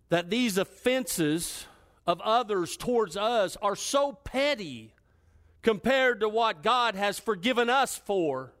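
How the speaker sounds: high, loud, punched exhortation; full-force sermon delivery; impassioned preaching. Real, not acted. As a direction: hammer each phrase home from the pulpit.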